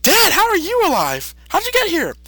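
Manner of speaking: high, surprised cartoon voice; Gohan. Gohan to his dad, high and amazed. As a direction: squeak out the amazement. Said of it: "Gohan's like".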